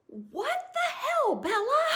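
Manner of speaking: high, loud, laughing "what the hell" at Bella; shocked laughing shout. Retold or imitated. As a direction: yell it out in stunned laughter. Friends' shocked laughing reaction.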